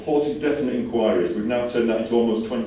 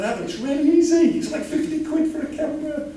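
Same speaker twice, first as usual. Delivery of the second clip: high mock protest. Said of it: "I said".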